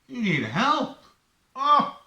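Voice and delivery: high, loud delighted cry; overjoyed dad. Retold or imitated. Dad thrilled his son finally asks for help, high loud cry. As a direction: burst out with joy at the news. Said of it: "What did he say after that?".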